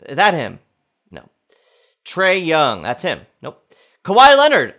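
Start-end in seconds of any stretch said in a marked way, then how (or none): 4.0-4.8 excited. Real, not acted. Quiz host calls name excitedly.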